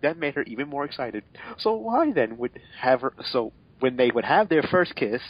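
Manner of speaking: ordinary delivery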